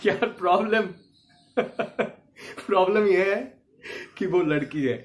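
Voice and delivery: laughs broken into the line, pitch lifted; comic laughing delivery. Shown as a demonstration. Actor demos the dialogue in comic mood, laughing. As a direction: giggle through the dialogue for comedy. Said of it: "तो इसको मैं हास्य रस में कहूं".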